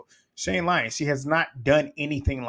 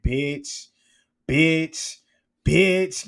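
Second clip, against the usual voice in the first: about to snap; 3 semitones higher, 4 dB louder, much slower than usual. Retold or imitated. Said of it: "laughing like she was about to tweak out".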